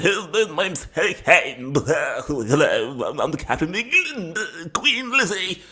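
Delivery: high, put-on voice mocking a footballer's speech; mocking Harry Kane. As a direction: ham it up like a schoolyard mockery, pitched high. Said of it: "he should now be like".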